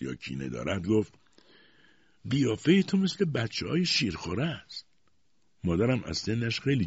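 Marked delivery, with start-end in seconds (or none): 2.3-4.9 high-pitched, half friendly, half spiteful; ambiguous tone. A narrator's reading. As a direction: leave it unclear whether fond or resentful.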